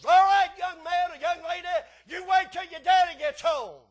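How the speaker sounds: loud scolding mother's threat; mama scolding. Retold or imitated. Mama warns the child, shouting. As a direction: shout it like an angry mama warning her kid. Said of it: "Mama says".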